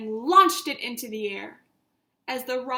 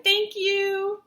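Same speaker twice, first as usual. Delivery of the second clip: higher and louder thanks; grateful shout; 8 semitones higher, 6 dB louder than usual. Acted. Marty shouting thanks to the comet, loud. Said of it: "Marty shouted".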